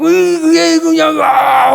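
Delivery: high, loud angry sputter; mock cursing noises. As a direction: imitate a man spluttering curses. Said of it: "저는 막 욕으로 하더라고요".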